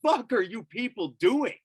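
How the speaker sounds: high, indignant holler; retold scream. Owner confronting cops battering his lab, high indignant yell. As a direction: re-enact screaming at intruders, pitch jumping up. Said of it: "and I scream".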